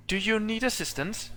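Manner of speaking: high, dutiful machine voice; robot medic. Acted. Warforged asking the commander if she needs help, high robotic voice. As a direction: offer help like a programmed servant.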